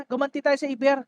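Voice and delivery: usual speaking voice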